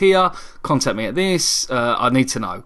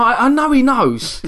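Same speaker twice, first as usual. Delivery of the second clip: rising embarrassed excitement; 12 semitones higher, 4 dB louder than usual. Real, not acted. Telling his cohost about the phone agent, climbing ever higher. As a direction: pitch climbs much higher with each line. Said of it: "retelling his embarrassment".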